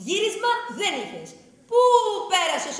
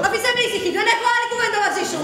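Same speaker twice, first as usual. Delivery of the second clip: angry; 5 semitones higher, 4 dB louder, faster than usual. Acted. Wife furious, ends the talk.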